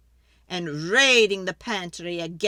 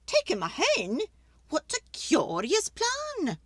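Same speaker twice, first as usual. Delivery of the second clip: put-on fretful old lady; 9 semitones higher than usual. Old lady begging the wise man for help, high and pleading.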